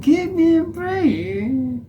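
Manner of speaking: very high, loud, drawn-out wail; hammed-up high-pitched imitation; exaggerated mimicry. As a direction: stretch it slowly in a squeaky high mock voice. Tries the clip's exaggerated swearing.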